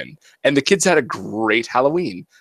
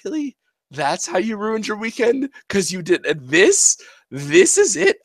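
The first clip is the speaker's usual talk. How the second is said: high, disbelieving questions; mock disbelief at a silly mistake; incredulous; 9 semitones higher than usual. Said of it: "And you're like".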